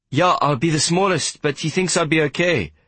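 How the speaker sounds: put-on high, boyish character voice; young boy. Boy tells the warder about his fight, boyish. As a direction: answer as a small boy, pitched well up.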